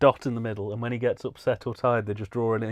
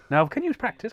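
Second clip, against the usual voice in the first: ordinary talk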